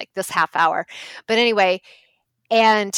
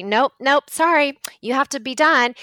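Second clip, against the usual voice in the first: imitated church lady, louder and higher; mock yell; 6 semitones higher, 5 dB louder than usual. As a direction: cut them off with a raised voice. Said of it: "started yelling at us".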